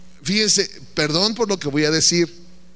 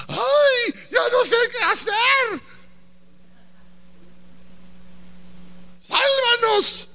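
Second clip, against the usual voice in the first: panicked wail; slower than usual. Acted. Josafat wailing to God in a high panicked cry. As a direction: wail it like a frightened king.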